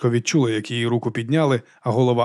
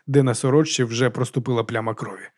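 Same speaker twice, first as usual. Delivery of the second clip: usual speaking voice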